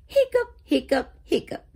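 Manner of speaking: high loud hiccup then quick ones; squeaky hiccup fit. Acted. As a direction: hiccup in a rapid string. Kitty hiccups in story.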